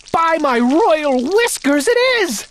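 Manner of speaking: king crying out in delight; excited cry. Acted. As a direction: crow with glee. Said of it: "he cried".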